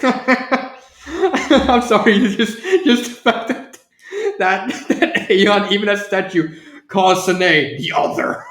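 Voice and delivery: helpless giggles breaking up the words; laughing while talking. Real, not acted. Apologizing for cracking up at the statue's remark, laughing. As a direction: struggle to get it out through laughter. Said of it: "I don't know why I'm laughing".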